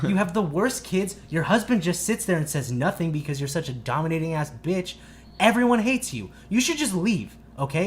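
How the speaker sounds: loud, high, relentless telling-off; retold screaming tirade. Teen berates the mom about her family, yelling. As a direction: pile on insults as a re-enacted rant. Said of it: "screaming at the top of my lungs".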